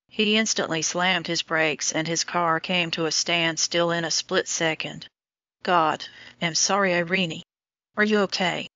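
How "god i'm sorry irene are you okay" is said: plain reading voice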